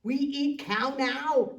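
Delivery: loud, slow giant roar; giants shouting. Acted. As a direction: bellow it like hungry giants. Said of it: "they shouted".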